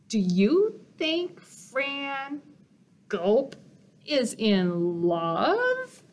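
drawn-out nervous question with a gulp; slow worried question. Acted. Sandy slowly asking friends if Fran is in love.

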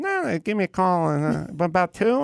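won't take no; imitating Leno.

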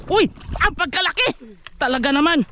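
high, excited exclamation; excited exclaim. Real, not acted. Angler marvels at the big amberjack, excited. Excited over the huge catch.

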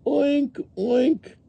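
very high, squealed oinks; pig oinks. A narrator's reading. The pig is saying, high oinking. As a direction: squeak out two piggy oinks. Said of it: "The pig is saying".

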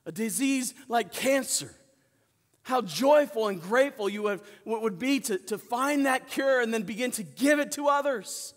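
preaching at full force. Acted. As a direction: preach it out to the congregation.

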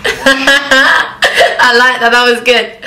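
loud delighted laugh through words. Real, not acted. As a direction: laugh out loud while praising. Laughing at the car joke.

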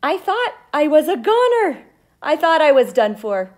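lively read-aloud storytelling; expressive storybook reading; story narration. A narrator's reading. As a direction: read it to the class like a gripping tale. Reads the seed's story aloud.

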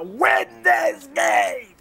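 rising, drawn-out rallying cry; rallying shout. Real, not acted. Gamer hyping his fam to win, rallying shout. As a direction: build up to a shouted vow. Same vow said low, then shouted.

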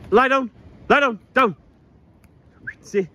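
ordinary talk

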